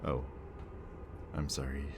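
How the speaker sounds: usual speaking voice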